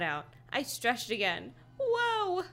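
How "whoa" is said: high, falling cry of surprise; high-pitched startled exclamation. A narrator's reading. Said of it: "I cried out".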